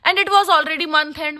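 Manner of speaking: normal talking voice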